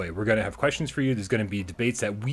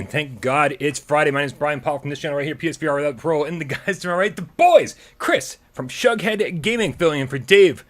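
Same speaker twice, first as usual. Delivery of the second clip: show intro; 6 semitones higher, 6 dB louder than usual. Acted. Opens the live show.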